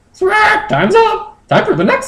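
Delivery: very high falsetto creature; squeaky bird-Pokemon voice; high Moltres voice. Acted. Moltres asking Justin to fly home, squeaky high.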